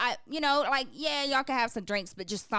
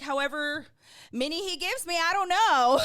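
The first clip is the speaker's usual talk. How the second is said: petty reply; 8 semitones higher, 4 dB louder than usual. Retold or imitated. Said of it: "just to be petty".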